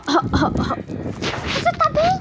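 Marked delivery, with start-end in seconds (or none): 1.5-2.1 high character voice. Acted. High concerned voice of a figure.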